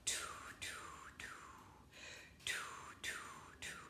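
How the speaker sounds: unvoiced whispered syllables, evenly spaced. Shown as a demonstration. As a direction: whisper each word as a separate puff of air. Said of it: "Then I have them whisper it".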